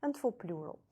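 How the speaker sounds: ordinary delivery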